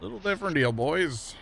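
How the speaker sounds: very high, sing-song auctioneer call. Shown as a demonstration. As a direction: hawk it to the crowd. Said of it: "Gotta sound like an auctioneer".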